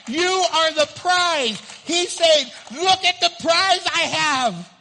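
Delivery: loud, high, ringing sermon delivery; full-force preaching. Acted. Pastor thundering to his congregation, loud preaching. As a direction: preach it out big to the whole room.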